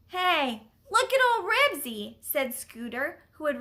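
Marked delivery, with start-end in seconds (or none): 0.1-2.2 high, loud and eager; excited call. A narrator's reading. Boy on bicycle spots the dog fight, excited.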